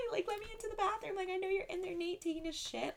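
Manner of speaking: imitating a whiny girl begging through a bathroom door; Maddie from Euphoria. Teller mimics Maddie banging on the bathroom door, high whiny plea. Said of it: "that scene from Euphoria where Maddie's like".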